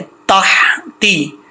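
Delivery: तहती with a long breathy throat हा after ता; thin हा. Shown as a demonstration. Teacher shows the thin हा, slow careful recitation. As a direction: stretch the whispery h in तहती, very slowly. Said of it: "यहाँ पे हा बारीक है".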